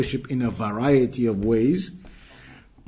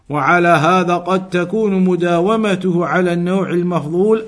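brisk, raised reading of a classical Arabic passage; reciting Arabic text; 5 semitones higher, 6 dB louder, faster than usual. Acted. Teacher reading the Arabic text aloud to students, brisk and raised. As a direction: read the book out quickly and firmly, like a lesson text. Reads Ibn Taymiyyah quote in Arabic.

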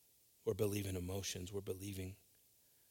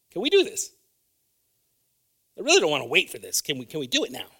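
fast, louder, higher pleading; a person begging for a quick fix, louder, higher and fast; impatient asker; 3 semitones higher, 7 dB louder than usual. Retold or imitated. Mock impatient plea, louder and fast.

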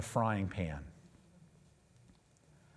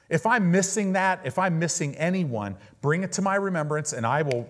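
lifted, fervent prayer, sharply louder on each Father; declared prayer; 3 semitones higher, 4 dB louder than usual. Shown as a demonstration. Pastor showing the church how he prays each morning, fervent declaring. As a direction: pray it out boldly as a daily declaration. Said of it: "I get up every day".

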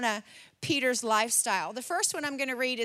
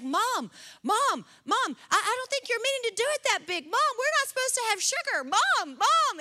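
high, urgent imitation of a panicking boy; kid freaking out; 10 semitones higher, 3 dB louder than usual. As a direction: plead with Mom in mock alarm, pitch climbing. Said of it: "freaking out".

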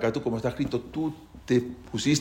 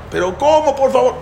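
high, louder begging protest; desperate plea; 9 semitones higher, 5 dB louder than usual. Acted. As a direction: beg in disbelief, pitched up. Convict protests reduced sentence. (súplica incrédula, aguda y fuerte)

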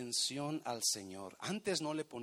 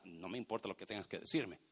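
dismissive husband; 7 semitones lower, faster than usual. Retold or imitated. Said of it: "Le está diciendo a su pareja".

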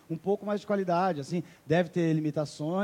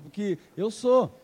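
ordinary talk, nothing marked

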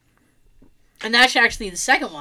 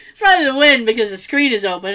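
laughing; 5 semitones higher, 6 dB louder, faster than usual. Retold or imitated. Mocking their own excuse.

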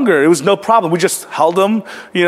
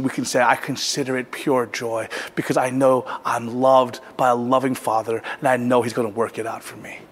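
soft, low pastoral reassurance; low-voiced, gentle pulpit close; hushed sermon closing; 8 semitones lower, 7 dB quieter than usual. Real, not acted. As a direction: wind down quietly toward prayer. Preacher's hushed closing before prayer.

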